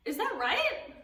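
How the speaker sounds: ordinary talk, nothing marked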